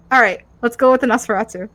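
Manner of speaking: ordinary talk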